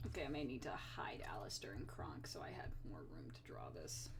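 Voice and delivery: soft whisper to herself. Real, not acted. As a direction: breathe the words, barely voiced. Muttering to herself while drawing.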